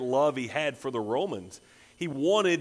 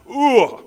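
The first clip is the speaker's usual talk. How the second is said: loud, sudden guttural "ugh"; anguished guttural outcry; guttural groan; 10 semitones higher, 12 dB louder than usual. Retold or imitated. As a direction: let out a loud groan, high then dropping. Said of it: "Just that Hebrew guttural".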